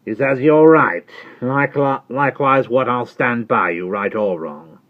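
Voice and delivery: high, loud rough servant voice; Jerry Cruncher. Acted. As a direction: answer her like a loyal, plain-spoken servant. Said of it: "returned Jerry Cruncher".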